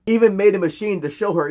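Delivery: ordinary delivery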